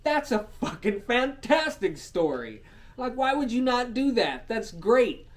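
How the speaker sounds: loud, high-pitched enthusiasm; real excitement. Real, not acted. Storyteller pitching his fantastic story, loud and excited. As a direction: sell the idea at full volume.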